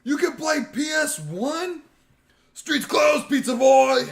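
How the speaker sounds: high, loud excited yell building to a shout; thrilled kid. Retold or imitated. Kid hyping the PS one racer scene, excited shout. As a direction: shout it like a kid who just saw the coolest thing. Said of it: "I was like".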